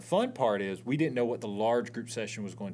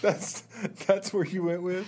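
higher, laughing incredulous question; amused disbelief; 7 semitones higher than usual. Real, not acted. Co-host hears a silly answer, laughing in disbelief.

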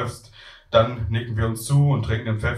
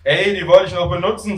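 raised-pitch student complaint; Tim protesting; 7 semitones higher, 4 dB louder than usual. Acted. Student protesting about his soiled yoga mat, raised voice. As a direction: object with the voice lifted.

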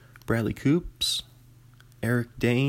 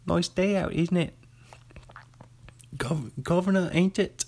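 high-pitched mock-British chirp; cod-British impression, pitched well up; rough British accent; 6 semitones higher than usual. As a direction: do a badly faked British governor greeting, voice raised. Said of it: "that's a rough accent".

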